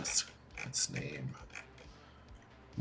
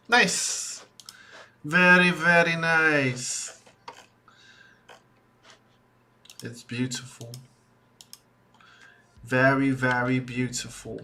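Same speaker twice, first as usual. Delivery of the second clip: put-on terrible British accent; 3 semitones higher, 6 dB louder than usual. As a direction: put on a mock posh British accent to admire your work. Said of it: "I have a terrible British accent".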